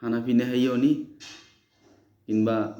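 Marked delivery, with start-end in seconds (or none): 0.0-1.0 Chittagonian dialect. Shown as a demonstration. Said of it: "আর আমাদের আঞ্চলিক ভাষা হলো".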